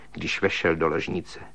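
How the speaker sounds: plain reading voice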